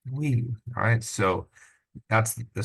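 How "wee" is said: a touch of ride thrill on wee, higher; mild excited wee. Shown as a demonstration. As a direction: lighter echo of the ride squeal. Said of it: "the excitement when you're at some ride".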